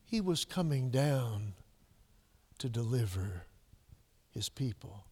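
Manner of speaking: soft, low and slow, deliver trailing away; hushed, slow. Acted. Preacher answering his own question, hushed and slow. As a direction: let coming down to deliver his people fall to a near-hush. Loud then hushed repeat.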